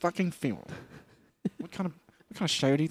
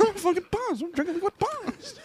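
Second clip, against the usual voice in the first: much higher silly voice, laughing; squeaky voice. Acted. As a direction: squeak it back. Echoing in high voice.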